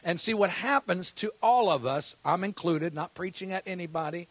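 ordinary delivery

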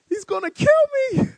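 high, strained panic; terrified thought. Retold or imitated. Rookie facing the huge linebacker, terrified and high. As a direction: squeeze it out in terror.

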